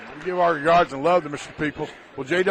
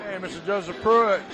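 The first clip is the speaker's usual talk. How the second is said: ordinary delivery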